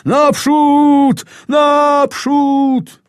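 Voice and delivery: high, loud, drawn-out forward commands. Acted. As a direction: outshout your comrade as you lead the charge. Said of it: "krzyczy jeszcze głośniej od niego".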